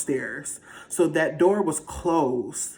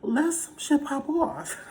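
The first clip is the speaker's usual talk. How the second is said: excited high; 12 semitones higher, slower than usual. Real, not acted. Repeated higher, laughing.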